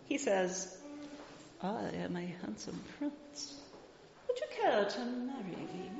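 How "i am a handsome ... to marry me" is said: a lightly voiced handsome prince, soft and courtly; courtly proposal, read gently. Mother reading aloud, voicing the prince's proposal.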